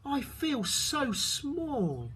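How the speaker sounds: small, high, slow snail voice; a tiny creature in awe. A narrator's reading. Snail tells the whale she feels small, high and slow. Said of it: "and she said to the whale".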